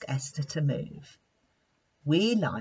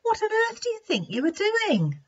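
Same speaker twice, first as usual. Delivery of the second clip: higher, playful wise-lion question; amused lion asking; 3 dB louder than usual. Acted. Wise lion gently scolds Monty, amused. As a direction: tease him about what he was up to. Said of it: "he said".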